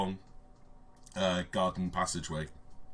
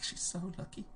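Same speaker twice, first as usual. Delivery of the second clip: put-on giggly gossiping girl; 11 semitones higher, 7 dB quieter than usual.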